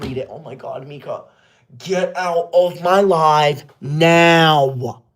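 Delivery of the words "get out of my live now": very slow, stretched-out order; a slow, deliberate order to leave; drawn-out command. Real, not acted. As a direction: drag every word out to kick them out. Ordering a troll out of her livestream.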